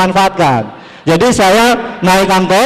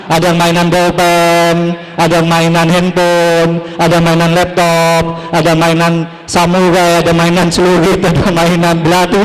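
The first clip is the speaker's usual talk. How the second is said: slow listing; 4 semitones lower than usual. Acted. Slow listing for comic effect.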